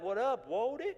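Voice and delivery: imitating friends' newly acquired street accent; put-on slang accent. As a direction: greet like a pal in a borrowed slang accent. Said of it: "Where did you even get that accent from".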